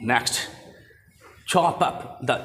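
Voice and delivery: normal talking voice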